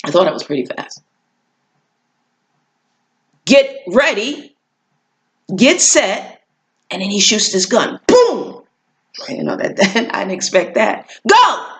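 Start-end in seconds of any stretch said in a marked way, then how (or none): 3.4-6.4 starter call. Retold or imitated. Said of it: "the starter says". 8.1-8.6 high sharp imitated gunshot boom; gun boom. Narrator imitating the starter's gun, sharp high boom. 11.3-11.7 high loud go; starter's shout. Retold or imitated. Race starter sending runners off, loud high shout.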